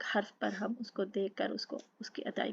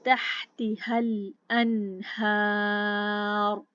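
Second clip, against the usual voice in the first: very slow, louder chanted verse; tajweed recitation; 6 dB louder, much slower than usual. Shown as a demonstration. Teacher recites the next verse for students, slow chant. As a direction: draw the verse out slowly. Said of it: "लाम साकिन के साथ पकड़ लिया".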